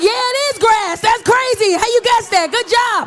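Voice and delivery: loud, high mock amazement; over-the-top cheering for a kid; exaggerated praise. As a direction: gush over a child's guess. Overpraising kid's guess in game.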